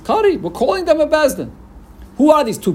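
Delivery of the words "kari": Kari said much higher, hit hard; stress on kari. Shown as a demonstration. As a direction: lean hard on Kari, pitch jumps up. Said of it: "the emphasis is on the word kari".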